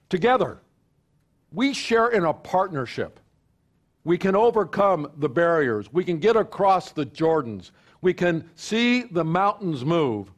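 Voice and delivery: building pastor's call to unity; preaching. Acted. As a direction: preach it out to the congregation. Preaching at full force.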